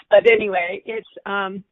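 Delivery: usual speaking voice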